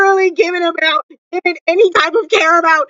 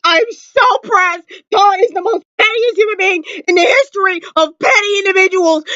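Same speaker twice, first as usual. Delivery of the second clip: normal talking voice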